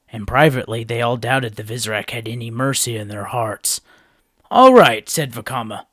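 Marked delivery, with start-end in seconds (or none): 4.5-5.1 high, loud rallying cry; rallying call. A narrator's reading. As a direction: call the team to move out. Vakama rallying the team.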